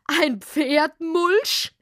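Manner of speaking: high, slow, laughing disbelief; laughing mockery. Hedgehog mocks the mole's name, laughing. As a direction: drag it out, cracking up with laughter. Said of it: "brach in schallendes Gelächter aus".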